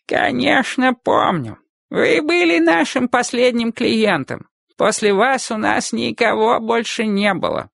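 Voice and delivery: put-on high, slow old man's voice. Old barber voiced by narrator.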